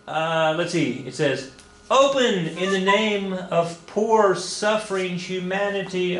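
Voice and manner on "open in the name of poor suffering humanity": high, slow, drawn-out plea; dramatic plea. Acted. Teacher reading a cartoon's caption to the class, dramatic plea. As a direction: read it as a grand, suffering appeal.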